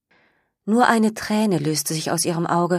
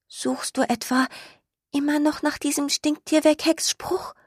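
trembling voice; 9 semitones higher than usual. Acted. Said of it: "Seine Stimme klang mit einem Mal ganz zittrig".

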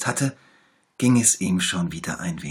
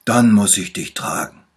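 lightly voiced little bear, louder; 2 semitones higher, 12 dB louder than usual. A narrator's reading. Little bear promises to heal the tiger, louder. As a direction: promise it brightly like a small doctor. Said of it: "fragte der kleine Bär".

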